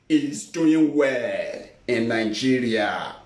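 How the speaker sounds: slow, low, dry sarcasm; sarcastic. Acted. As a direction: drag it out with irony. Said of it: "I'm just being sarcastic there".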